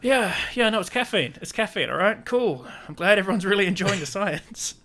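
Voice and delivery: high-pitched, put-upon politeness; mock forced enthusiasm. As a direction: act out explaining it for the umpteenth time. Acting out weary repeated explanations.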